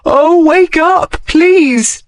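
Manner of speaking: high, slow desperate plea; a high, slow, breaking entreaty; begging. Acted. Begging unconscious man.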